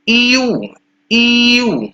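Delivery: teacher modelling "ईयू" for pupils; model sound eu. Shown as a demonstration. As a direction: say "ईयू" slowly and cleanly as a model for the class. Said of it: "ई को और W को मिला के ईयू पढ़ते हैं".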